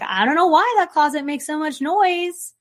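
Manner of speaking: lightly voiced oblivious person; clueless. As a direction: play dumb about the noisy closet. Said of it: "and then being like".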